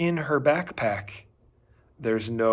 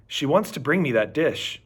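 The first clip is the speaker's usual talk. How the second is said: usual speaking voice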